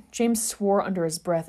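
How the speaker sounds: ordinary delivery, nothing marked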